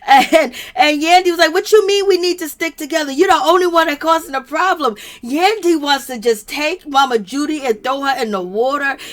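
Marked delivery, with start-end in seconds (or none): 1.5-4.9 higher-pitched, pushed retold comeback; indignant retort. Yandy answering Mama Judy's family plea, indignant. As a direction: imitate an indignant comeback, voice raised high.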